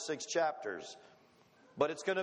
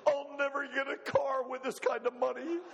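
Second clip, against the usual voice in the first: mock son; 8 semitones higher than usual. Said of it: "he's looking at his paycheck".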